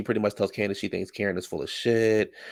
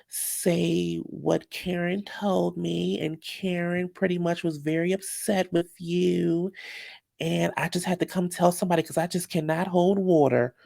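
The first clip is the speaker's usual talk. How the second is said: higher, slow, drawn-out mimicry of a tattletale; mock gossip; 7 semitones higher, slower than usual. Recapping a friend who spills what Karen told her, mock gossip. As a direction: put on a sweetly reluctant tattletale, high and slow.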